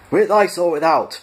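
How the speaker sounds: high, loud bellow. A narrator's reading. Guard bellowing a drink question at prisoners: high, loud. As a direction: bellow it like a drill sergeant. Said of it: "bellowed number two".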